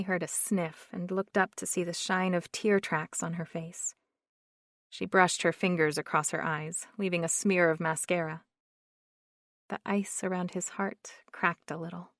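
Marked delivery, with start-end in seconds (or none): none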